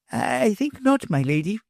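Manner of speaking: put-on high, halting old professor; hesitant reply. A narrator's reading. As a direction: hold back carefully. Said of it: "said with some hesitation".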